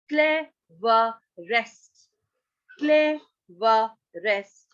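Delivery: "cleverest" very slowly as cle, ve, rest; broken into syllables. Shown as a demonstration. Teacher showing superlative form, "cleverest" split in three. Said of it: "break the word in two".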